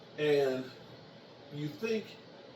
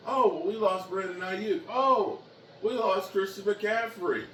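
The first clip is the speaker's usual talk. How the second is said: higher, louder mock whine; mock whiny fan; 7 semitones higher, 6 dB louder than usual. Mocking rival fans' excuses, higher and louder whine. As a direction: mimic a complaining rival fan. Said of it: "here's what I hear from Whiner fans".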